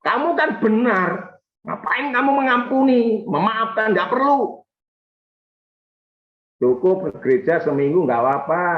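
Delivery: high, coaxing devil voice; tempting devil. Acted. Devil talks a believer out of forgiving, coaxing. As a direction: coax like a tempter, pitched well up. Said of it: "Selama ini saya ditipu oleh iblis".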